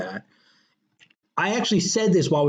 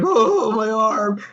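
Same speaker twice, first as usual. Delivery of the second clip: mock moaning; 6 semitones higher, much slower than usual. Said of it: "Jason Bateman's like".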